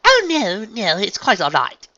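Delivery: high, loud, drawn-out politeness; through a strained smile. A narrator's reading. Unicorn excusing her friend's slip, strained politeness. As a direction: reassure through a forced smile. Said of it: "through a strange smile".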